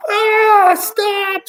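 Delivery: very high, loud scream. Acted. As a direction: shriek at him to stop. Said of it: "screamed ground control".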